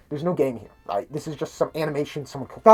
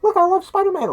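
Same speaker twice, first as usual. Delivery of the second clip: put-on much higher, louder little-kid show-off voice; squeaky kid voice; 8 dB louder than usual. The kid showing Spider-Man his toys, high squeaky voice. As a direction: chirp it like an eager little boy.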